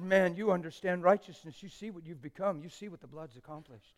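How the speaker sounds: soft, hushed encouragement to the mirror; quiet, sincere talk to his own reflection; tender pep talk. Retold or imitated. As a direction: speak to yourself gently, like a proud friend. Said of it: "I said".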